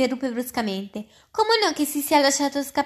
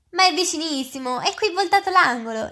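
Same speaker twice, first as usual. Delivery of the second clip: higher-pitched, mildly insistent urging; 6 semitones higher, 5 dB louder than usual. A narrator's reading. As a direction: press the point eagerly, pitch lifted. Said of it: "insistette il fauno".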